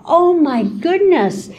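usual speaking voice

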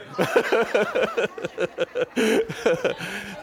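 high giggling laugh; amused laughter. Real, not acted. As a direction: laugh at your own prank. Laughing after scaring audience.

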